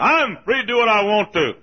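high, loud mock protest; defiant objector. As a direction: mimic people insisting on their rights.

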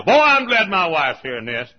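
mock smug husband. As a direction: gloat like a husband elbowing his wife. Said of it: "somebody's saying right now".

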